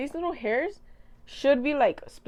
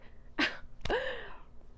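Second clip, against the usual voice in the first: breathy laugh; 13 semitones higher, 7 dB quieter than usual. Real, not acted. Laughs at own bragging.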